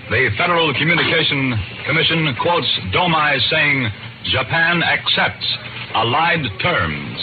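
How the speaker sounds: urgent radio news bulletin; news wire bulletin. Acted. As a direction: read it as breaking wartime news. Wartime radio bulletin read.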